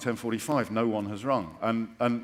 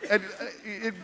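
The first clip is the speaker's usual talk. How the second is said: ordinary delivery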